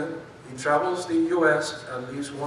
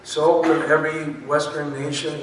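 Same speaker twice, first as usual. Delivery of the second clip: louder, corrected take; emphatic correction; 8 dB louder than usual. Shown as a demonstration. Host correcting his misread intro, louder. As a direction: restate it firmly and louder. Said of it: "I'm sorry".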